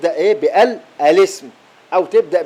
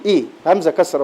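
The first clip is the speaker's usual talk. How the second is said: ordinary talk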